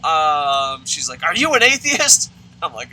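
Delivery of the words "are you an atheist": imitating her loud, high demand; hammed-up outraged question, voice raised; mad accuser. Mad colleague confronting the teacher, imitated angry shout. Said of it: "and she's mad".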